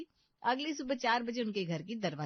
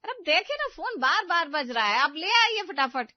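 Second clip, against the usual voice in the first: high, loud insistence; loud urging; 7 semitones higher, 8 dB louder than usual. Acted. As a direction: push him to hurry, much higher and louder. Mother-in-law urges about phone. (ऊँची, ज़ोरदार ज़िद)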